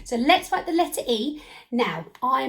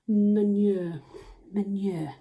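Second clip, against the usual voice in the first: normal talking voice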